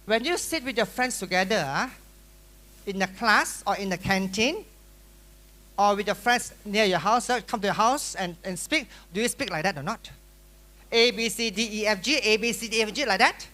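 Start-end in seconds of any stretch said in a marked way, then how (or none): none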